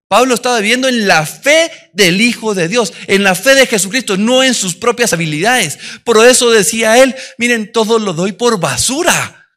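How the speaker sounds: loud, rising sermon delivery; loud passionate preacher; fervent preaching. Acted. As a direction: preach it out with full conviction. Preaching at full force.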